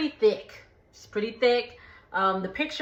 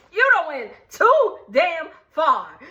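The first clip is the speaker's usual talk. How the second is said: slow, louder and higher through laughter; laughing mock outrage; 6 semitones higher, 5 dB louder than usual. Real, not acted. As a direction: drag it out, laughing in disbelief. Joking outrage at a comparison.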